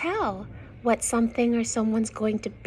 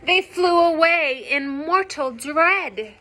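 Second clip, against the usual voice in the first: clear, louder storybook reading; story narration; 5 semitones higher, 10 dB louder than usual. Acted. Reading a picture book to young children, lively narration. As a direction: read the rhyme out to the children.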